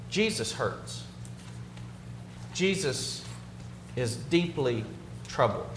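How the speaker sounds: low, soft and broken by long pauses; hushed solemnity. Real, not acted. Preacher telling the congregation of Jesus' pain, hushed and solemn. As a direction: let each phrase sink in, quietly.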